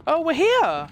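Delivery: bright, loud burst of delight; thrilled to arrive; excited. Real, not acted. Excited arrival in new area.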